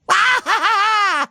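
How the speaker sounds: high, giddy laugh; gleeful cartoon laugh. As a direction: laugh with sheer delight. Laughing on a thrill ride.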